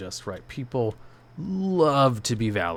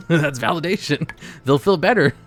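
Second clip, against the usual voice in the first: louder, bright laughing delivery; 5 semitones higher, 6 dB louder than usual. Real, not acted. Laughing as streamer sums up validation. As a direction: laugh it off, pleased with yourself. Laughs after own joke.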